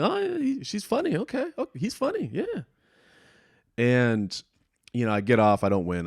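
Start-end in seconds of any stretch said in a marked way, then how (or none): 0.0-2.6 very high, surprised mock voice; hammed-up high surprised judge; surprised judge impression. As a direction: play her startled, reluctant praise. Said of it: "she seemed a little surprised".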